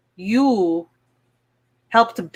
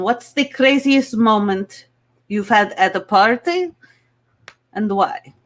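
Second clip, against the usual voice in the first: put-on heavy Russian accent. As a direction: play it as a Russian contestant. Miss Russia.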